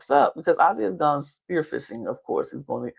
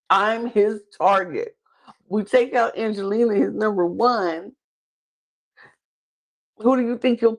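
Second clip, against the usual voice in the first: exasperated appeal; 6 semitones higher than usual. Acted. As Emily confronting dismissive Ozzy, exasperated appeal. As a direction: spell it out as if he is not listening. Acting out what she'd tell Ozzy.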